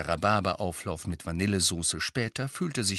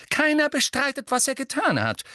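viel höher und lauter; erhobene Stimme; 5 dB louder than usual. A narrator's reading. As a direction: die Stimme zornig heben. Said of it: "mit erhobener Stimme". (Mutter hebt die Stimme gegen Sirius, laut)

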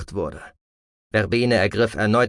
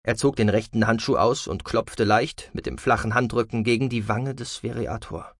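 ordinary delivery, nothing marked

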